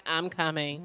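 flat, low "I'm coming"; dead flat, no emphasis; deadpan. Retold or imitated. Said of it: "You was just like".

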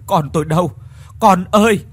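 desperate calling. Acted. Father calling for his drowned son, frantic cry. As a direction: call out for the lost son.

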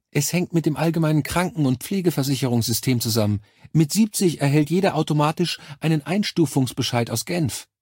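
high, saccharine nurse voice; Krankenschwester, übertrieben herzlich. A narrator's reading. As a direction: talk down to the caller with sugary cordiality. (hohe, aufgesetzt herzliche Schwesternstimme)

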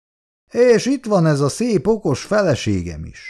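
high-pitched sarcastic opening, then dropping; mocking echo. A narrator's reading. Husband parrots his wife's words, mocking sarcasm. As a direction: throw the words back mockingly, starting high.

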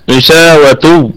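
very slow غشاوة ending in تُ; joined form. Shown as a demonstration. Teacher shows the joined reading of غشاوة, slow. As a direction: stretch غشاوة and release it as غشاوةتُ. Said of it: "في وصل هتكون تاء".

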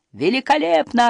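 high-pitched mock shout of delight; restrained narrator's version of a yell; exultant yell. A narrator's reading. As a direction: call it out high, thrilled, without full volume. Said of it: "орал Крис".

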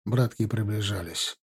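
plain reading voice

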